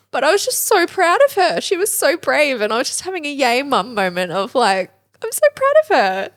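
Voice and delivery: fighting back happy tears while telling it; teary proud mum. Real, not acted. Mum chokes up telling of daughter's first school day, teary and high. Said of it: "You feel all the emotions?".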